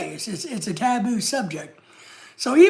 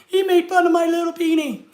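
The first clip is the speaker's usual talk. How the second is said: whiny fake sobbing, much higher and a bit louder; mock crying troll; 6 semitones higher, 3 dB louder than usual. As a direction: ham up a crybaby troll whining to mommy. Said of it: "going back to the basement crying".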